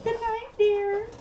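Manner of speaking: high, slow, put-on sweet goodnight; sing-song goodnight. Saying good night to the viewers, high and drawn out. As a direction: lift it up high and draw it out.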